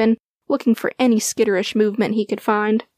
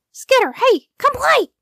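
high, loud little dog calling out; a little dog excitedly calling a friend to play; 4 dB louder than usual. A narrator's reading. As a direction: call out eagerly to a squirrel.

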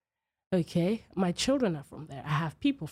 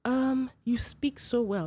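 high, slow patronizing imitation; condescending; 6 semitones higher, slower than usual. Store lady looking at an African customer, condescending. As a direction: draw out the praise in a higher put-on voice. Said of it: "sound condescending".

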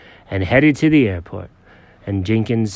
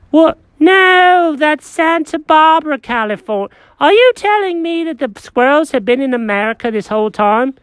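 high, fussy cat voice; Jenkins the cat; 4 dB louder than usual. Acted.

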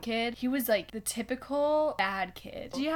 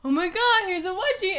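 higher, louder imitation of an excited kid; mock gleeful prank; 8 semitones higher, 5 dB louder than usual. Prankster to her sister in a retold story, mock gleeful. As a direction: play up the childhood prank announcement.

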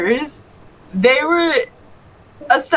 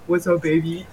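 imitating a deep flirty voice; 6 semitones lower, 6 dB quieter than usual. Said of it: "he's like".

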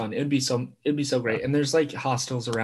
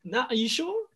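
gushing delighted tourist; 12 semitones higher than usual. Pretend. Imagining himself in an Italian town, high gushing tourist. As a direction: gush over everything like a wide-eyed tourist. Pretend tourist claiming Sicilian roots.

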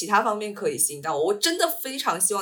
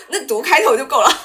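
laughing; 6 semitones higher, 12 dB louder than usual. Real, not acted. Teasing a co-host, laughing.

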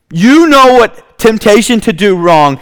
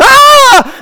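long, very high-pitched mock scream; acted scream; 4 dB louder than usual. Potiphar's wife calling the guards, high acted scream. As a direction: let out a sustained high shriek. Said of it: "she decides to scream".

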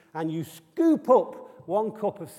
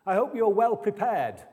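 much higher, louder mock panicky boy; anxious child; 9 semitones higher, 3 dB louder than usual. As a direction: squeal it like a kid sure the zombies are coming. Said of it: "And Dan said".

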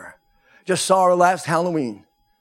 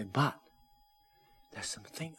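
hushed aside; 5 semitones lower, 17 dB quieter than usual. Retold or imitated. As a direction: drop almost to a whisper. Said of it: "I go like this".

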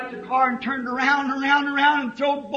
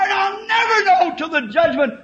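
high, loud preacher's shout; preaching; 9 semitones higher, 7 dB louder than usual. Real, not acted. Preacher to his congregation, shouting the mystery. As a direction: cry it out from the pulpit.

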